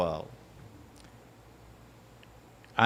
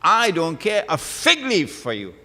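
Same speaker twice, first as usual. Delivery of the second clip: loud, higher-pitched defiant outburst; real heated dismissal, voice raised; 6 semitones higher, 6 dB louder than usual. Real, not acted. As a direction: throw it at them, loud and high. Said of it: "Sorry for raising my voice".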